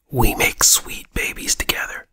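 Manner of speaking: hushed, voiceless whisper; intimate whisper. Acted. Producer confessing to his partner on the phone, in a soft whisper. Acted secret aside on phone call.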